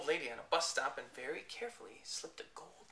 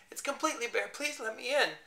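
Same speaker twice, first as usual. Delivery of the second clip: high, louder muffled-sounding plea; voice wearing clothes; 8 semitones higher, 5 dB louder than usual. Acted. Clothed bear begging at door, as if clothed. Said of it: "a voice that sounded as if it came from somewhere wearing clothes".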